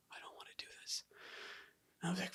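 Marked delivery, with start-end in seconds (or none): 0.1-1.0 whispered, half-hearted protest; hushed reluctant murmur to oneself. Retold or imitated. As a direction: whisper it like a private complaint. Reluctant reply to the Spirit, retold.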